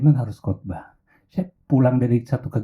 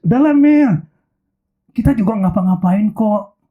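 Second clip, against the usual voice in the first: high, louder eager persuasion; eager coaxing; 6 semitones higher, 3 dB louder than usual. Acted. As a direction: push her, all eager. Ex pushing Melissa to go. (mantan membujuk Melissa, bersemangat dan keras)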